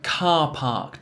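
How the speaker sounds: car park with two long ahs. Shown as a demonstration. Teacher models car park for repetition. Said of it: "Try and repeat this after me".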